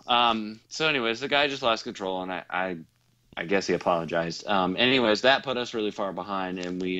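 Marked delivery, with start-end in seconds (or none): none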